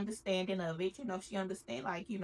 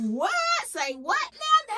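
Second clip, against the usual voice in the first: shocked shout; 14 semitones higher, 6 dB louder than usual. Retold or imitated. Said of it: "they like".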